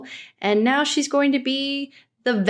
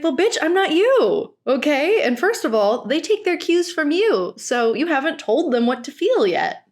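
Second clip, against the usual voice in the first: sassy comeback; 6 semitones higher than usual. Pretend. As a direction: snap back at her with attitude. Imagined retort to Teal.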